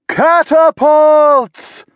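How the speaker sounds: high, slow, loud yell; warning shout to everyone inside. A narrator's reading. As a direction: raise the alarm at full voice. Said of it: "Florence yelled".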